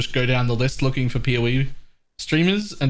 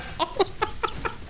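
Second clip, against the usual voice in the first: sudden high-pitched giggle; 8 dB quieter than usual. Real, not acted. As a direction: laugh it off in a squeak. Laughs after feeling attacked.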